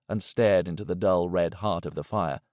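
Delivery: usual speaking voice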